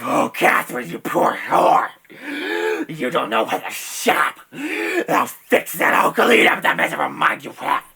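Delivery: slow, loud, high ranting; unhinged tearful shouting; deranged fury. Acted. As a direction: rage at the puppet in hysterics. Dave rages at puppet, deranged.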